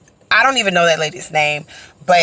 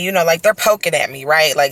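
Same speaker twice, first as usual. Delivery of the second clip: usual speaking voice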